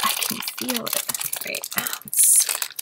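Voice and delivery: "I can feel it" breathed out as a secret whisper. Real, not acted. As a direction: whisper it like you're sure of a rare. Repeats phrase, second whispered.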